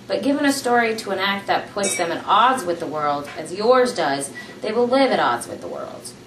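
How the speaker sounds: ordinary delivery, nothing marked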